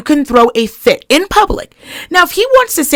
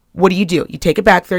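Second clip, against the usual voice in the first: normal talking voice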